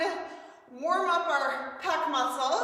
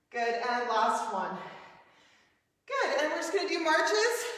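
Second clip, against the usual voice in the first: ordinary talk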